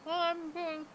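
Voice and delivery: talking while chewing; words muffled by food; mouth full. Real, not acted. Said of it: "I'm gonna eat".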